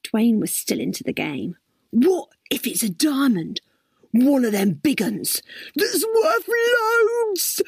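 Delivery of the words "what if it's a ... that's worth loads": rising, wide-eyed wonder; excited guessing. Acted. Dwayne still into the guessing game, growing excited about a diamond. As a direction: build up to a high, drawn-out finish.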